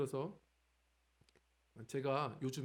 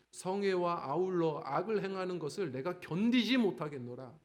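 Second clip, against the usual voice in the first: louder, solemn group Bible reading; scripture reading aloud; 5 dB louder than usual. Shown as a demonstration. Pastor leads the church in reading the verses, louder. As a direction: read the verses out with the congregation. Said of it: "한번 읽어볼까요? 시작".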